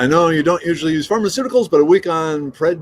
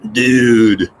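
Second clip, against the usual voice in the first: drawn-out California surfer dude; California accent; 3 semitones lower, 6 dB louder than usual. Shown as a demonstration. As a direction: lay on a loud California accent. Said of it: "can you do a California accent".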